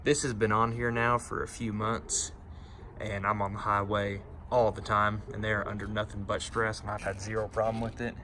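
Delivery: normal talking voice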